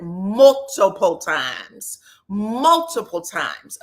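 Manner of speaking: "multiple" drawn out slowly and punched; emphatic stress. Real, not acted. Recapper insisting he has said it before, "multiple" stretched emphatically. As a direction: lean hard and slow on "multiple".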